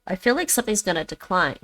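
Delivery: ordinary delivery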